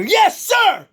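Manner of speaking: high, loud fired-up yes sir; shouted reply. Retold or imitated. Fighter answering his coach between rounds, loud. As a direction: bark it back, all pumped up.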